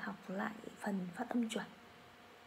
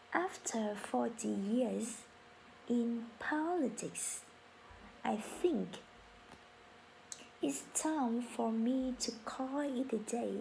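very slow, syllable by syllable teacher read; slow model sentence; slower than usual. Shown as a demonstration. Teacher reading for class to repeat, very slow. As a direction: drag it out so learners can follow. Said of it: "read for repetition".

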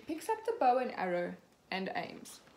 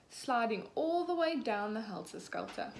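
ordinary delivery

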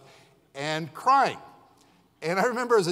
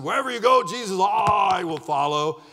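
eager vow; 3 semitones higher, 5 dB louder than usual. Retold or imitated. Preacher voicing Peter pledging to Jesus, loud and eager. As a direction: swear it with puffed-up zeal.

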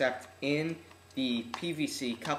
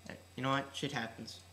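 ordinary talk

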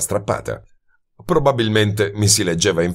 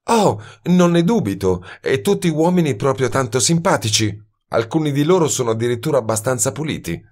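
higher, sly mocking woman's voice, quick at the end; a woman sneering at detectives; mocking young woman; 7 semitones higher than usual. A narrator's reading. Woman mocks detective's colleagues, lightly voiced.

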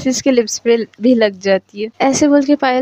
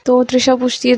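usual speaking voice

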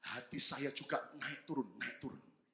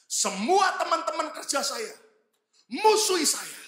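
lamenting church member; 10 semitones higher, 7 dB louder, slower than usual. Retold or imitated. Congregant laments being shunned.

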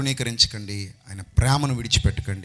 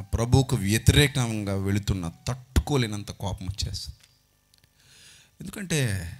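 ordinary talk, nothing marked

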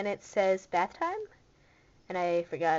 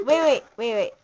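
loud wait; 2 semitones higher, 12 dB louder than usual. Real, not acted. Calls out suddenly to stop.

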